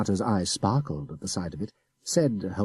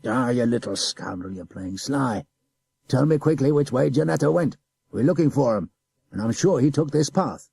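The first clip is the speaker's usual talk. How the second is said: gruff officer, louder on the orders; scolding adjutant; 5 semitones higher, 4 dB louder than usual. A narrator's reading. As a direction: scold the sly boy.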